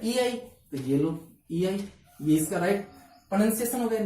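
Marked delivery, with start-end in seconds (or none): 0.0-1.8 ईआई drawn out slowly; slow emphasis. Shown as a demonstration. Teacher writes the diphthong symbol for the class. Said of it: "ए का symbol क्या होता है".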